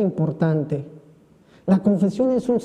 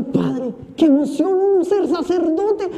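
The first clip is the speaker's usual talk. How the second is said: put-on excited girl; 7 semitones higher, slower than usual. Excited young woman quoted.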